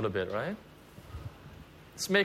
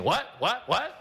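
rude repeated what; 8 semitones higher than usual. Retold or imitated. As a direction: snap back like you can't hear. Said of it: "you can be rude and keep saying".

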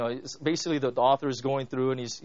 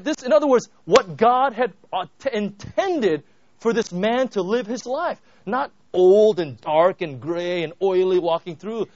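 louder and higher, fervent preaching; preaching climax; 4 semitones higher, 9 dB louder than usual. Real, not acted. Preacher to congregation, fervent and loud. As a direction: drive it home with rising passion.

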